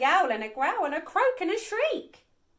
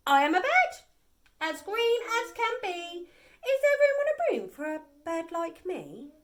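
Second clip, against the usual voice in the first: higher, chirpy bird voice asking politely; 4 semitones higher, 4 dB louder than usual. A narrator's reading. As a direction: play an eager little bird. Bird character asks.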